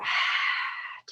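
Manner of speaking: long breathy sigh out; releasing yoga exhale; audible exhale. Shown as a demonstration. Exhaling aloud with the class.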